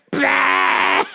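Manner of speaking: a loud breathy laughing shout; mock burst. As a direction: explode with a laughing bah. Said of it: "they're like".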